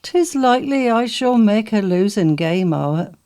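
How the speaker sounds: usual speaking voice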